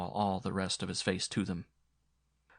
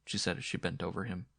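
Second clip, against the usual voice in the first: usual speaking voice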